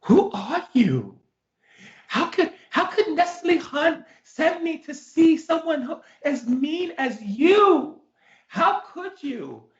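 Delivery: high, drawn-out appalled wail; acted outrage. Retold client reacts, appalled.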